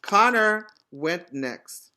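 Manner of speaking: ordinary talk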